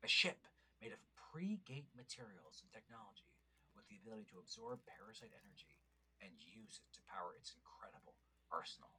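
hushed epic narrator voice; old sci-fi announcer. Pretend. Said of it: "a fun voice".

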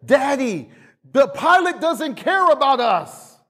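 put-on high whiny child's voice, lightly done; panicked child. Pastor imagining a fearful son complaining, mildly high. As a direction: voice a panicking little boy. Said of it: "Instead of".

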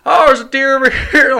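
high, loud calling out with a laugh; retold yell. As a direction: imitate a buddy hollering he found the deer. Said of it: "Charles starts yelling".

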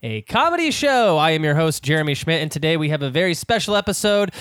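fast, loud morning-show host read; radio host intro. Acted. Host opening the broadcast to listeners, brisk radio patter. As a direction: rattle it off like live radio.

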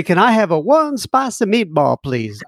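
put-on halting phrasebook tourist, Italian accent; bad Italian accent. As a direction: order dinner in a hammy Italian accent. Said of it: "that's my bad Italian".

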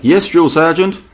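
mock soldier answering a sergeant; yes sir military reply. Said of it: "She's like a drill sergeant".

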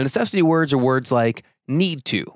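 normal talking voice

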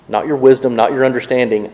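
ordinary talk, nothing marked

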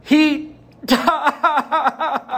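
high, half-laughing imitation of a sobbing child; mock the child's heartbroken wail, laughing through it; heartbroken little girl. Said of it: "And Kenna says".

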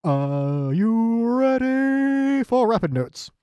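high, long-drawn-out announcer call; mock show intro. Co-host announcing the rapid notes segment, drawn-out hype call. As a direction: stretch out each word like a hype intro.

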